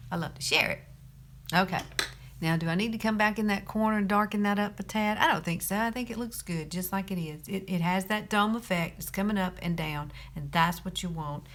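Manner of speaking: normal talking voice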